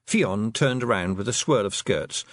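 ordinary delivery

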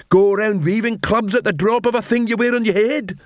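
indignant troll. Acted. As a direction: scold the trolls as a fed-up copper.

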